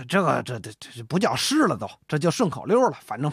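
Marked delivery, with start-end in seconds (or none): none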